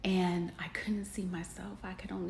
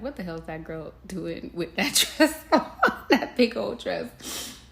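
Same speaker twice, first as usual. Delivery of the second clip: louder mock judgment with laughter; mock judging; 9 dB louder than usual. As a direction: voice the gawkers, laughing. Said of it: "They could have been looking at me like".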